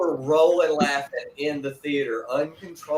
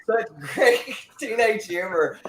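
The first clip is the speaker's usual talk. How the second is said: higher, slower, laughing through it; 6 semitones higher than usual. Real, not acted. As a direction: let the laugh spill into the words. Laughing at the film.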